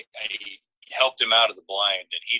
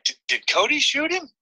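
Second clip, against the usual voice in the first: much higher, lightly voiced eager dad; excited dad. Dad asking if Cody shot the turkey, mildly excited. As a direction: squeak the question out with surprise. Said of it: "he's like".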